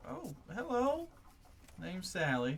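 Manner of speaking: high, slow country drawl; Sally introducing herself to the player, high and slow; Sally's voice. Acted. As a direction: introduce yourself like a friendly farm wife. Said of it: "she looks at you and goes".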